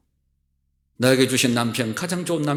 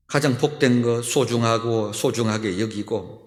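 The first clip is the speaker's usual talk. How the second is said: closing prayer; 3 semitones lower than usual. Acted. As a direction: pray it gently and reverently.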